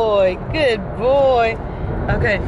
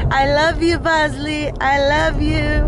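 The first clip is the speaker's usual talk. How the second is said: high, drawn-out cooing; adoring pet talk; 5 semitones higher than usual. Real, not acted. Owner to beloved dog before the clinic, high adoring coo. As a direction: coo lovingly to a dog, pitched up and lingering.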